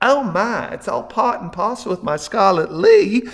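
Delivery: high, swooping Southern belle; Deep South drawl. A narrator's reading. Big B explaining the code name, high Deep South belle drawl. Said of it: "said aloud in a Ziz Deep South drawl".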